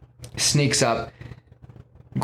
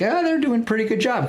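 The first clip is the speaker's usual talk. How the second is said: high, fast and mocking dismissive; dismissive gods; 13 semitones higher, faster than usual. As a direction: toss it off in a high, quick, condescending voice. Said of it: "and they are kinda like".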